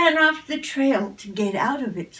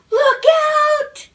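much higher, louder cry of alarm; alarmed shout; 9 dB louder than usual. A narrator's reading. Goose warning the fox about a crocodile, alarmed shout. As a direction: yell the warning with all you've got.

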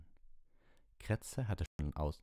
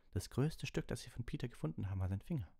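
higher, gentle teacher voice; Lupin; 8 semitones higher than usual. Acted. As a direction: ask it like a patient teacher.

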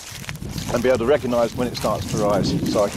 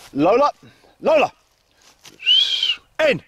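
much higher, louder calls across the field; calling the dog; 14 semitones higher, 3 dB louder than usual. Real, not acted. Owner calling Lola back before she runs off. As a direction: shout her name to bring her in.

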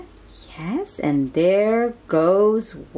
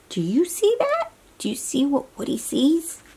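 much higher astonished question; wide-eyed higher ask; amazed; 5 semitones higher than usual. Real, not acted. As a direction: gasp out the question in wonder.

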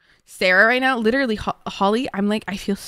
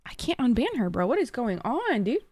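ordinary delivery

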